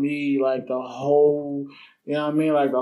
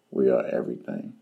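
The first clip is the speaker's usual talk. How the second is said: deep proclamation; 14 semitones lower, 3 dB quieter than usual. Shown as a demonstration. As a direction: drop way down like a grand declaration.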